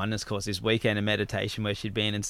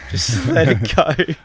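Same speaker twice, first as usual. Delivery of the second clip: slow, louder, breaking into laughter; laughing; 7 dB louder, slower than usual. Real, not acted. Repeating the animal book's advice, laughing. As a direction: laugh through the words as you repeat them.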